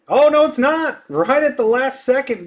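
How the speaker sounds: high, loud sudden surprise; surprised. Real, not acted. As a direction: caught off guard by a late bid. Last-second bid surprises him.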